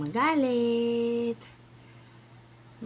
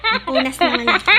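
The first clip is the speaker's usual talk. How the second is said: laughing; 4 semitones higher, 6 dB louder, faster than usual. Real, not acted. Laughing while wiping.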